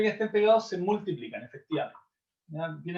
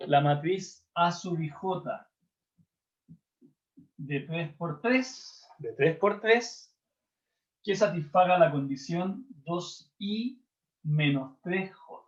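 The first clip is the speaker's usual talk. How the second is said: slow dictation; slower than usual. Real, not acted. Teacher dictating the task slowly.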